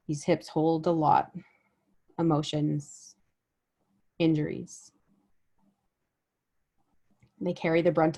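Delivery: unhurried meditation guide, long pauses between phrases; guided body scan meditation. Real, not acted. Guide helping listeners notice their bodies with each breath, guided meditation. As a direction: lead them gently through the body, letting each phrase settle.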